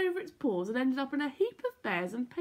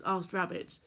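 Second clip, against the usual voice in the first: ordinary delivery, nothing marked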